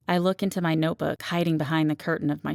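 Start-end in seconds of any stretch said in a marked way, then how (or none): none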